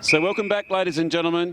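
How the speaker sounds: high, projected broadcast greeting; sports announcer welcome. Real, not acted. Commentator welcoming listeners back for the last quarter, high announcer delivery.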